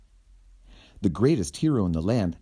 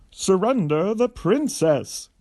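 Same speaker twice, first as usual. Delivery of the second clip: loud, high, slow heroic yell; 10 semitones higher, 5 dB louder than usual. Acted. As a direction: bellow the demand at the villain. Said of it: "he yelled".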